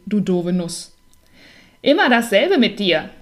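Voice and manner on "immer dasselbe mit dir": mild louder yell; a lightly voiced roar at the nut; peanut bellowing. A narrator's reading. Peanut yelling at the clumsy hazelnut, mild yell. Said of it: "brüllte die Erdnuss".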